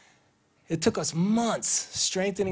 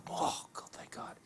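whispered, breath only; relieved whisper; 13 dB quieter than usual. Retold or imitated. Relieved to hear from Rod, whispering thanks.

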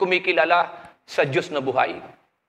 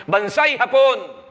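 shout; 5 semitones higher, 3 dB louder, slower than usual. Retold or imitated. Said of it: "Sumigaw ang Hapon".

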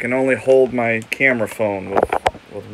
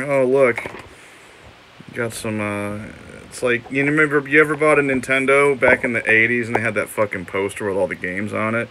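ordinary talk

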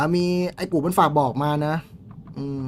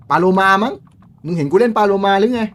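irritated retort; 6 semitones higher, 8 dB louder than usual. Real, not acted. Snapping at a viewer's question.

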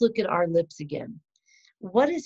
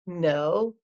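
usual speaking voice

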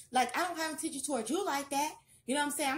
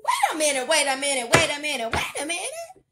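much louder, repeated, climbing high at the end; excited wait a minute; 4 semitones higher, 13 dB louder than usual. Real, not acted. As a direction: stop everything in excitement. Surprised by matching cards.

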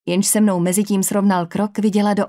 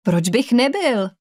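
slow, higher-pitched puzzled question; mild surprise, pitch lifted; surprised; 4 semitones higher, slower than usual. A narrator's reading. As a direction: answer as if taken aback, drawing it out. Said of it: "odpověděl překvapeně".

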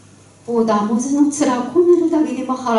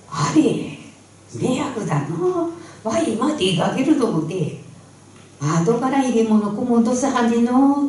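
put-on higher polite old woman; old granny voice; 6 semitones higher than usual. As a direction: politely fuss like a busy granny. Granny answers neighbour. (高めの声の丁寧なばあさま)

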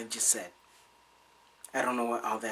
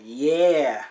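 loud, stretched imitation of her "Yeah"; imitating her reply; 10 dB louder than usual. Showing what she said, loud mimicry. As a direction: belt out a mock drawn-out yeah. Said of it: "See what she said".

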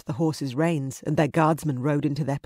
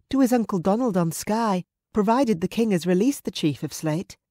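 higher-pitched Scottish mother character; fond mother; 5 semitones higher, 3 dB louder than usual. Acted. Mother telling her companion about her son, fond higher voice. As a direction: play a mother reluctant to let go. Mother answers where he goes.

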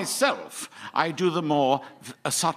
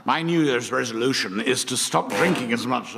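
pirate voice; 7 semitones lower than usual. Acted. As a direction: lower growly pirate accent. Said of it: "he's talking like a pirate".

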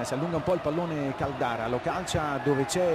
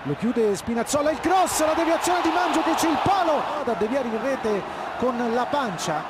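rising excited shout, higher and louder; breathless italian goal commentary; 7 semitones higher, 4 dB louder than usual. Real, not acted. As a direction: erupt as the ball hits the post. Goal chance, commentator erupts.

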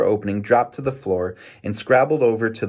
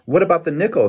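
higher, louder challenging question; demanding; 8 semitones higher, 5 dB louder, faster than usual. A narrator's reading. Boy challenging his winning brother, demanding. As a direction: demand an answer, pitch raised. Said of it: "demanded Mike".

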